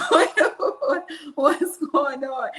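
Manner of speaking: amused, pressing what's going on; laughing prodding. Retold or imitated. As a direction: laugh through the repeated what. Prodding quiet son, laughing.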